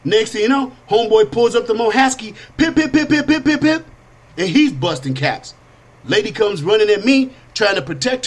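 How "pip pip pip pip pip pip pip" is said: high, rapid, even pip sounds; high-pitched volley of vocal gun pips; imitated gunfire. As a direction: fire off a burst of mouth-made shots. Imitates a gun being fired.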